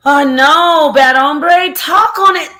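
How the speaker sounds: loud, high hyped-up call; excited shout. Real, not acted. Streamer hailing a chatter, excited shouting. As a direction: cheer him on loudly.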